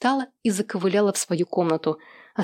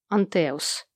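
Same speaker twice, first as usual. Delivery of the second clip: usual speaking voice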